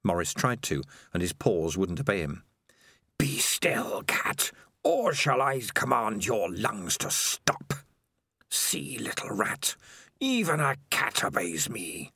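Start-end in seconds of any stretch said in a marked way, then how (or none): 3.2-12.1 slow, ghostly whisper from the dark; a creeping, hissing whisper of a lurking monster; eerie spider voice. Acted. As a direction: breathe it like a spider in his head. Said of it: "said the voice of Spider".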